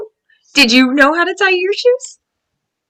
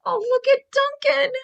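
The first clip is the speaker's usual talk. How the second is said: usual speaking voice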